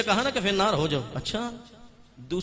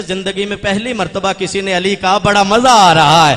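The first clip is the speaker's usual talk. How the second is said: smiling; 3 semitones higher, 15 dB louder than usual. Acted. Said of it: "Abbas मुस्कुरा के कहते".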